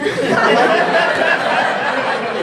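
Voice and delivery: loud high cackle; high laugh. Real, not acted. Comedian cracks up at own joke.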